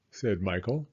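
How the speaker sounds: ordinary delivery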